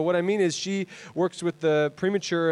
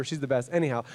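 ordinary talk

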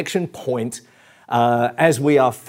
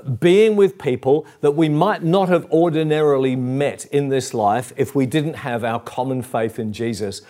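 usual speaking voice

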